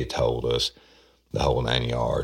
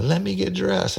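falsetto panicked woman pleading; high mock wail. Detective imitates the suspect pleading innocence, falsetto whine. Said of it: "she's like".

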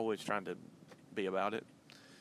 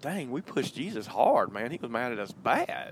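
mock sheepish disciple; 3 semitones higher, 8 dB louder than usual. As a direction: drag it out like a disciple who just got scolded. Imagined disciple admitting fault.

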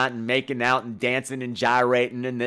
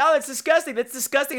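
disgusted crowd; 12 semitones higher than usual. Retold or imitated. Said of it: "And then everyone's like".

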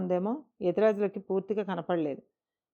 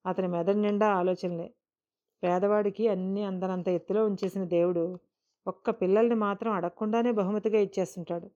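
ordinary delivery

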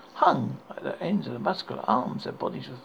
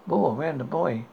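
usual speaking voice

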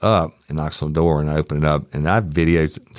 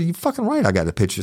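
angry; 11 semitones higher, faster than usual. Retold or imitated. Retorting to officer.

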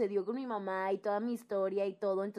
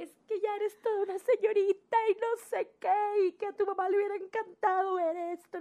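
higher, choked-up dad voice; weepy proud father; 9 semitones higher than usual. Retold or imitated. Father to his daughter after her first period, teary voice. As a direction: say it like a father tearing up with pride.

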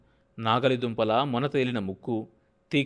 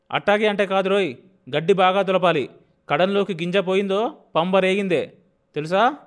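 loud, higher, threatening tone; stern warning; 7 semitones higher, 7 dB louder than usual. A narrator's reading. Landlord warning Yellamanda not to lose grain, loud and stern. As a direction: lay down the law with force.

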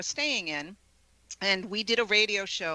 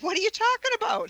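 much higher, incredulous question; retold surprise; 4 dB louder than usual. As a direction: sound totally baffled. Wife baffled by husband's accusation.

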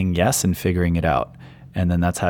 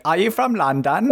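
high-pitched mock British accent; 11 semitones higher than usual. Co-host teases storyteller about asking a Londoner, in mock British accent. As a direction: put on a playful, high British lilt. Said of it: "you did it in the accent".

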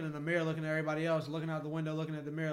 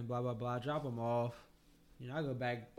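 ordinary delivery

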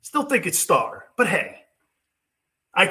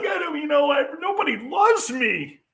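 high, whining mock quarterback voice; mocking a sulking quarterback; whiny Favre impression; 10 semitones higher, slower than usual. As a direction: whine it like a moping star who feels unloved. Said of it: "Favre was like".

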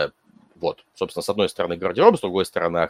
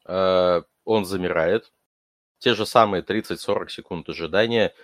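normal talking voice

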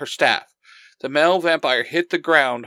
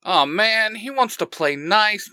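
higher-pitched, exasperated sigh of a line; sighing complaint; 7 semitones higher than usual. A narrator's reading. Mikhail grumbles as the captive cooperates, sighing. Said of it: "Mikhail says with a sigh".

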